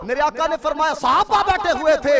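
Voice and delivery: normal talking voice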